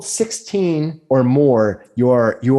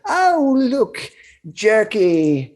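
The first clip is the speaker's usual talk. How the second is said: put-on old crone voice; 9 semitones higher, much slower than usual.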